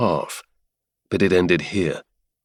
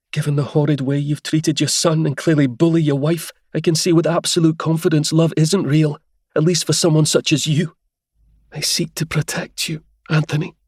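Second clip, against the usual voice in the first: higher, lightly voiced young woman, confident and steady; Helia, bold young lady; 7 semitones higher than usual. Helia defies the Duke in a higher, confident woman's voice. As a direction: lift into a higher woman's voice, bold but calm.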